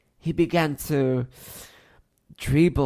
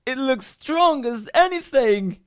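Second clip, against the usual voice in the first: put-on high, loud child's voice; confident child; 9 semitones higher, 7 dB louder than usual. A narrator's reading. Another child answering the older girl, confident. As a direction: reassure breezily. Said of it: "the other said".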